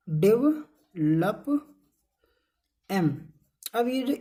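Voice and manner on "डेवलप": "डेवलप" very slowly in pieces, dev-lup; syllable demonstration. Shown as a demonstration. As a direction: draw out "डेवलप" with a gap before "lup". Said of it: "अगर हम इसी को बढ़ा दें". ("डेवलप" को बहुत धीरे, टुकड़ों में बोलें)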